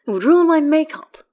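huffy, high and loud retort; snappish. A narrator's reading. Astarion explains why he won't get wet, high snappy retort. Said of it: "Astarion snaps".

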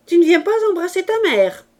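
high, loud, fast cry; exclaimed. A narrator's reading. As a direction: cry out impatiently for the child to come. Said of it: "s'écria-t-elle". (cri aigu et pressé de mère)

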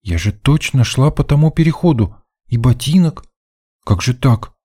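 put-on high, puzzled girlish protest; bewildered girl. A narrator's reading. Girl protests at dead end. (растерянно оправдываться высоким девичьим голосом)

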